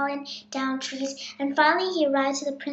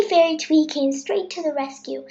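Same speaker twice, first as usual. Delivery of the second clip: ordinary delivery, nothing marked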